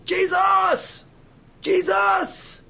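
high, loud holler; desperate yell. Acted. Seated blind man calling to Jesus, desperate yell. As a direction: cry out the name at full force. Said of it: "yelled that name".

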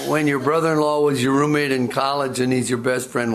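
usual speaking voice

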